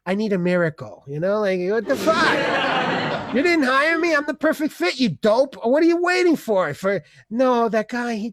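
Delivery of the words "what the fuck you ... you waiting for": high-pitched indignant rant; mock outrage. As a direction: demand answers as the snubbed candidate. Mock outrage at rejection by employer.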